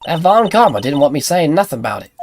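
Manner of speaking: folksy southern tattling; lightly put-on southern accent; Lotta, southern accent. Lotta tells on Von Karma, southern accent. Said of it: "a better southern accent than you".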